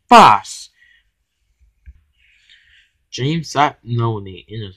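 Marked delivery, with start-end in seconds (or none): 0.1-0.7 "fast" punched out much louder; urgent stress. A narrator's reading. Manager needs new engines, urgent stress on "fast". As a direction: hit "fast" hard and loud.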